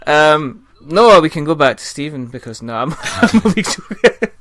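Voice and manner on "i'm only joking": loud, high, laughing through the words; amused backtrack, breaking into laughter. Real, not acted. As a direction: laugh off your own teasing. Host laughs off his teasing.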